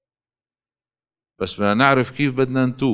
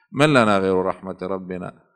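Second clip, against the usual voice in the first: ordinary talk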